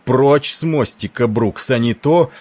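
loud, drawn-out threatening roar. A narrator's reading. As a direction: bellow a slow warning to clear out. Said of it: "взревел Тиндал".

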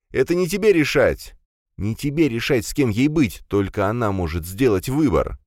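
high, sharp angry retort. Acted. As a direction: snap back in anger. Commissar argues with brother.

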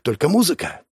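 ordinary delivery, nothing marked

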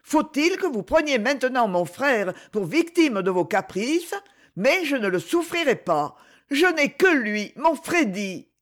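high, impassioned accusation; anguished anger, protective of a brother; angry reproach. A narrator's reading. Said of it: "où passait un souffle d'irrésistible colère".

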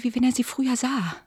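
ordinary talk, nothing marked

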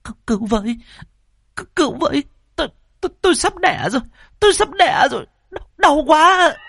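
high, desperate cries of a woman in labour; crying for help. A narrator's reading. Pregnant daughter screaming for help in labour pain, high desperate cries. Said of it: "mà kêu la".

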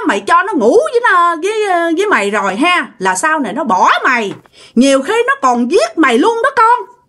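shrill ghostly scolding; ghost woman warning. Retold or imitated. Ghost woman warns the girl about the boyfriend. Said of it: "giọng của bả nghe vang vang, rền rền".

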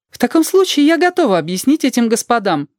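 high, formal character voice; creaky-voiced woman. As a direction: announce readiness in a high pitch. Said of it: "Она говорит своим скрипучим голосом".

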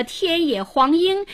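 high-pitched anxious calling of names. A narrator's reading. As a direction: call after the runaways. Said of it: "喊着".

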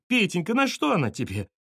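high-pitched pleading lament; lamenting mother. A narrator's reading. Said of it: "запричитала маманя, перестав голосить".

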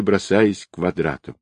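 ordinary delivery

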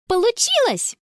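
put-on high-pitched girlish exclamation of triumph; delighted cry. Girl phones her friends with good news, delighted high cry. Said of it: "в восторге кричала она в трубку".

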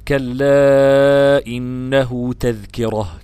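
very slow chant of كلا إنه تذكرة, كلا joined to إنه; joined recitation. Shown as a demonstration. Teacher models joining كلا to the next words, slowly chanted. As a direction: join كلا to what follows in one slow chant. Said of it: "وصلك بقى بكلا بما بعدها".

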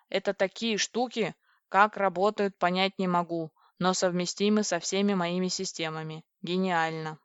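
plain reading voice

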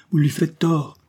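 reproche plus haut et plus fort; défend son fils d'un ton vif; s'écrier. A narrator's reading. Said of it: "s'écria la réveillère". (reproche vif, plus aigu)

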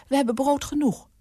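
high-pitched housekeeper's objection; high voice, brushing the suggestion off; protesting housekeeper. A narrator's reading.

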